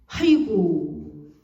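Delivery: slow drawn-out 아이고 lament; mock wail. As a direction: stretch it out like a grieving sigh. Wife retelling her misery, wailing. (길게 늘어뜨린 탄식)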